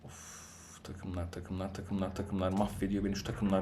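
fed-up player moaning about his team, quiet and drained; exasperated groan. Real, not acted. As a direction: sigh it out, defeated. Groaning about bad teammates.

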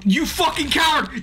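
angry yell. Real, not acted. Streamer yelling at his timid game character, angry yell. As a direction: yell the insult at the screen.

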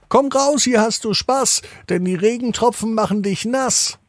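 very high, tiny raindrop pleading at the window; small raindrop asking to come in. A narrator's reading. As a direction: pitch way up like a little drop of rain. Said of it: "Der dritte Regentropfen macht es den anderen nach".

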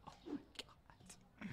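slow, hushed whisper of awe; awed whisper. Real, not acted. Streamer awed by a pile of stock, slow whisper. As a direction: breathe it out in amazement.

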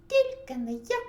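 very high, loud squeal; squeaking mouse. A narrator's reading. First mouse refuses to knead dough, squeaking. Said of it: "запищав Круть".

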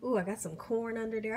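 ordinary talk, nothing marked

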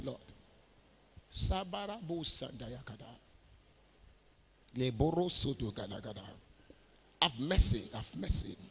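soft, low murmured plea for mercy; hushed, low praying aloud; penitent prayer. Real, not acted. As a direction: plead quietly with God, pausing between short phrases. Leads a prayer of repentance.

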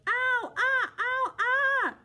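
high, loud pained cries; yelling in pain. A narrator's reading. Bear under the mosquito pile, high pained yelling. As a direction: yelp as the bugs bite. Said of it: "something was yelling".

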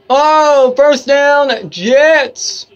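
loud, high cheer; excited play call. Real, not acted. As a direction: shout it out as the play lands. Calling a first down while watching.

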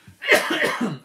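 a sudden rough cough that catches the throat; real coughing fit. Real, not acted. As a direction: cough hard, caught off guard. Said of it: "I just had a bit of a coughing fit".

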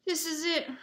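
high, loud moan of despair. A narrator's reading. Ron at the locked door, moaning. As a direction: wail it hopelessly. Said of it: "Ron moaned".

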